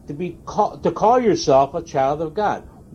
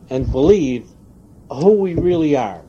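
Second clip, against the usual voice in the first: ordinary delivery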